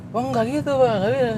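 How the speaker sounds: high, soft imitation of a friend; Lea. Imitating how a friend talks back to strangers, high soft voice. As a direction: pitch it well up and keep it soft. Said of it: "nggak bisa gua ngomong kayak Lea".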